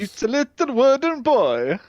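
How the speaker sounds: high, slow, loud cartoon delivery; mock storybook voice. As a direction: draw it out in a high silly voice. Mocking Pinocchio film's character voice.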